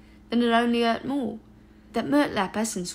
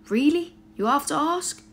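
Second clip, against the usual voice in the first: incredulous, higher and louder; exasperated; 6 semitones higher, 4 dB louder than usual. A narrator's reading. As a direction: scoff in disbelief. Exasperated reply.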